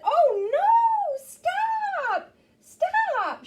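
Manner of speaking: high, loud shout; girl shouting stop. Acted. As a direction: yell stop like a little girl. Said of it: "And she said".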